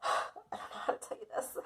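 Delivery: hushed, heavy theatrical confession; dramatic whisper. Retold or imitated. Daughter breaking bad news to parents, dramatic whisper. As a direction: breathe it out like a soap opera reveal. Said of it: "I was very dramatic".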